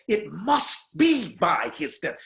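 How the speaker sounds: slow, each word "It must be by his death" given weight; measured, heavy reading of "It must be by his death"; slow deliberate take. Shown as a demonstration. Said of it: "You can do anything you want with that".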